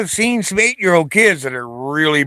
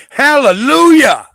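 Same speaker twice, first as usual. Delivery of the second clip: "Hallelujah!" yelled much higher and louder; mock roaring cheer; 10 semitones higher, 7 dB louder than usual. Imitating Moscow cheering Trump's win with a loud roar. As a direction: bellow it like a cheering crowd. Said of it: "They were roaring and screaming".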